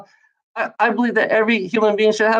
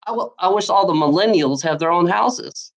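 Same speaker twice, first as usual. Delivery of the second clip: normal talking voice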